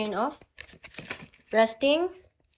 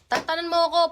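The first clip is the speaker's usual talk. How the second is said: higher, sharper snap; acted anger; 6 semitones higher, 3 dB louder, faster than usual. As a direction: play it angry. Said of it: "Paano pag galit?". (galit na pagtataboy)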